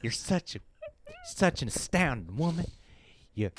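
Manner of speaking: put-on Southern gentleman's accent; hammed-up Southern charm. Shown as a demonstration. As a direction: pay a courtly compliment in a Southern accent. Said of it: "My southern accent sounds a little bit more like, like this".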